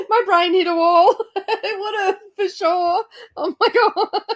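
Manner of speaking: high-pitched, breaking into laughter; real laughter spilling over the words; laughing through words. Real, not acted. Laughing at a reading mix-up.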